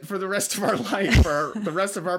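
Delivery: high, loud outburst to a partner; excited exclamation. Real, not acted. Joking with wife about their future.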